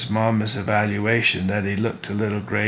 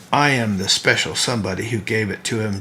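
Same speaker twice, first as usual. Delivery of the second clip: plain reading voice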